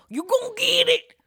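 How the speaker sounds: high, loud backwoods holler; imitated catchphrase. Teasing a friend about his new mustache, high mock holler. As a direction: mimic your friend's hillbilly tagline. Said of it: "that mustache that makes you go".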